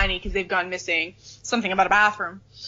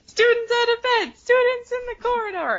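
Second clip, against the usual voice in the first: high, frantic shrill caretaker cry; shouting Filch. Retold or imitated. Filch running the halls, shouting at unseen students. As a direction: yell it like an alarmed old caretaker. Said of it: "Filch comes, like, running".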